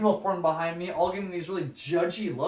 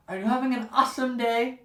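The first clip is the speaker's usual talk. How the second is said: mock-chipper cashier voice, higher; perky cashier; 5 semitones higher, 4 dB louder than usual. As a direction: parrot her cheerful script.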